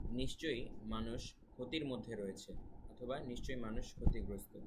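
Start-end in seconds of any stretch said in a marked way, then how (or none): none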